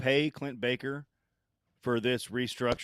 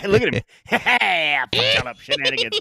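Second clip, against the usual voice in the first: laughing; 7 semitones higher, 7 dB louder than usual. Acted. Joking about leprechaun Tom Cruise.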